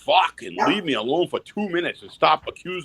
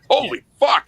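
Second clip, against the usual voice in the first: retold shock; 13 semitones higher, 6 dB louder than usual. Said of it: "she's like".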